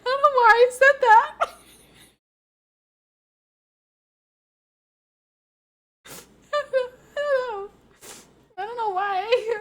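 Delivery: high, loud, laughing through every word; can't stop cracking up at herself; real giggle fit, words squeezed out through laughter; helpless giggling. Real, not acted. Giggle fit over her own joke.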